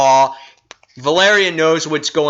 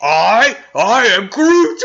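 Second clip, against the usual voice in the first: swelling creature roar, ending high, loud and slow; Groot; 5 semitones higher, 5 dB louder, much slower than usual. Acted. Reviewer voices a toothpick becoming a giant tree creature, swelling bellow. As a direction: build each word bigger, like a growing giant. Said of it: "he starts growing and growing. He's like".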